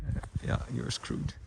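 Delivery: soft, nearly breathless aside; quiet murmur. Real, not acted. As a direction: let it fall away quietly. Voice fades on a grim point.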